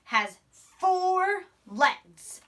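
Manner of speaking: A sheep has four legs read very slowly; teacher reading the book line slowly; slow read-aloud. Shown as a demonstration. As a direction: read the sentence slowly, word by word. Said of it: "and it says".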